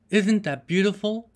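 high, bright burst of delight; excited exclaim. A narrator's reading. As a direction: gasp it out at the rainbow. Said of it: "suddenly exclaimed Puss".

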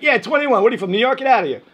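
high, loud scoffing; mocking bouncer. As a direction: scoff at the kid from Boston.

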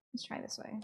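quiet unvoiced aside; whisper. Real, not acted. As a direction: whisper it to yourself. Aside while exploring.

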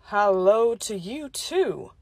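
slow, low Texas drawl; Texan accent. Acted. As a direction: Texas cowboy charm. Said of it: "exotic accent".

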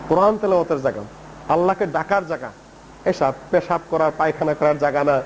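low, measured and unhurried; calm, patient explaining. Retold or imitated. Prophet explains to a newcomer what a mosque is for, calm and patient. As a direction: gently explain it, pausing between phrases. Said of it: "ধীর স্থির হয়ে বুঝাইলেন".

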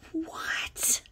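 high, soft, breathy what; confused whisper. Retold or imitated. Her reply to the catcallers, confused whisper. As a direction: whisper it, baffled. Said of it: "And I'm like".

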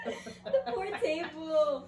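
laughing hard. Real, not acted. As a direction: laugh through it. Bursts out laughing.

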